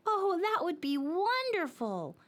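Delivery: high, slow, delighted little voice; happy dreidel. Acted. Dreidel thanks the children for a new home, happily. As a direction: let the joy spill out. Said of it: "said the dreidel happily".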